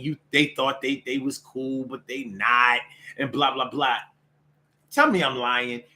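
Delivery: ordinary talk, nothing marked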